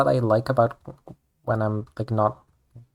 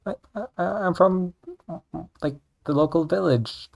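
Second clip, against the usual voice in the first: high, stammering, caught-off-guard reply; lightly voiced nervous self; flustered answer; 7 semitones higher than usual. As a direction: trip over your words, embarrassed. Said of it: "I was like".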